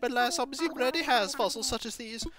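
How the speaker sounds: a polite scholar tempted by fossils; owl curator voice. Acted.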